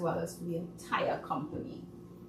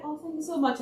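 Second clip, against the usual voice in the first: mock-polite higher thank you; gushing thank you, pitched up; high gracious thanks; 8 semitones higher, faster than usual. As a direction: play it sweet and gracious. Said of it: "I'm like".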